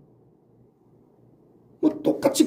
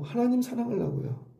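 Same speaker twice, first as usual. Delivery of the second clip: soft answer; 5 semitones higher, 8 dB quieter than usual. Pretend. Imagined answer, second time.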